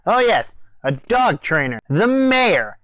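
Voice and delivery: ordinary delivery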